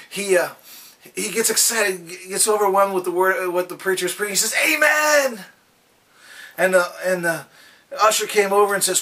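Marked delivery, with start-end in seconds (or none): 4.7-5.5 a sudden, much higher shouted "Amen.". Retold or imitated. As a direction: let the amen burst out. Visitor can't hold back amen.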